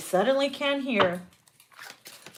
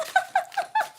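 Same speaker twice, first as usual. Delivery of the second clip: high squealing laugh; 4 dB quieter than usual. Real, not acted. Cracking up.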